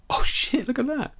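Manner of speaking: high startled yelp; surprised. Real, not acted. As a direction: blurt it out in shock. Sudden magenta light surprise.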